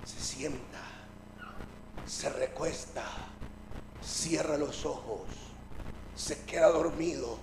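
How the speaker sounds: very soft, low, with pauses; drowsy storytelling hush; hushed narration. Acted. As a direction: lull it down as he falls asleep. Narrates Jesus settling to sleep.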